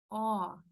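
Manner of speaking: O opened wide and projected up, slightly through the nose. Shown as a demonstration. Teacher demonstrates the throat position, open projected O. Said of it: "más arriba, incluso, eh, hasta por la nariz".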